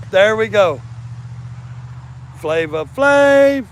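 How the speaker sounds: very high, loud, drawn-out shout; excited shout. Real, not acted. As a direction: belt the name out slowly. Repeats name loudly.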